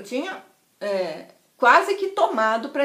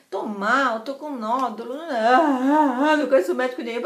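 hammed-up wailing sobs; mock-bawl through the words, building louder; crying like crazy; 4 dB louder than usual. Tells friend of her nodule at the bar, mock bawling. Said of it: "chorei que era uma maluca".

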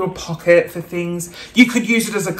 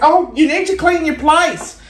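higher mock scolding; annoyed guest; 8 semitones higher than usual. Guest scolding her about the mess, mock scold. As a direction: imitate a fussy visitor. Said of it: "They're like".

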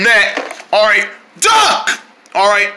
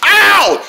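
pained yelp; 8 semitones higher, 4 dB louder than usual. Acted. Character gets hit by thrown object.

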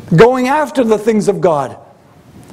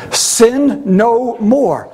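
very slow, each word stretched; drawn out; much slower than usual. Acted. Preacher repeating Jesus' command to the congregation, slow and weighty. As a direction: draw out "Sin no more" deliberately.

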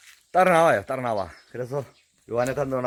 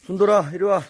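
loud call across the yard; raised calling voice; calling the dog; 6 semitones higher, 6 dB louder than usual. Real, not acted. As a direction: call him over firmly. Owner calling dog over.

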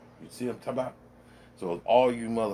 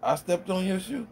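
mock surprise; 8 semitones higher than usual. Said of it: "And I turned around".

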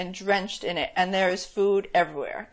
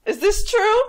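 whiny giant voice; 12 semitones higher, 8 dB louder than usual. Acted.

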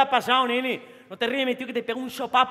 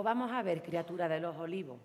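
soft mock scolding; 6 semitones lower, 11 dB quieter than usual.

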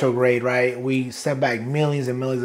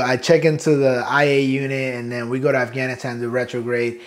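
normal talking voice

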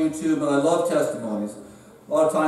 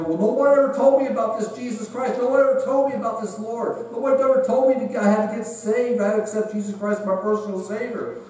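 higher-pitched, pleading excuse; protesting sinner; 6 semitones higher than usual. Acted. Unsaved sinner before God at judgment, protesting. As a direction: protest your innocence in a raised pitch, insistently.

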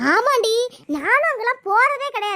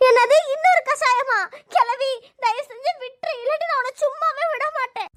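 crying protest; 4 semitones higher than usual. Acted. Labouring woman refusing another potion, tearful shouting. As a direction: wail a threat through tears. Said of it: "இவ கத்தி ஊரே கூட்டுருவா".

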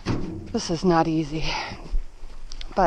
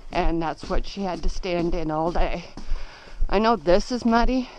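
normal talking voice

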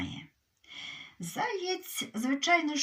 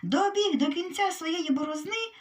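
ordinary delivery

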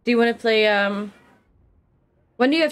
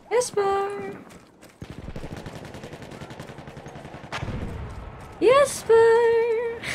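calling out; 14 semitones higher, much slower than usual. Real, not acted. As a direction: call out to get his attention. Calling friend across voice chat.

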